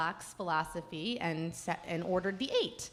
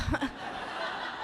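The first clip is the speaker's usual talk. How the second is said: breathy laugh; 5 semitones higher, 4 dB quieter than usual. Real, not acted. Pins popping, sheepish bride.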